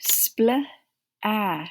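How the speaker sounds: usual speaking voice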